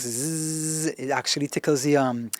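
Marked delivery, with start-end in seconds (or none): 0.0-0.9 soft buzzing zzz; buzzing Z sound. Shown as a demonstration. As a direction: buzz a quiet Z to tickle the vocal folds. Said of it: "doing Z songs".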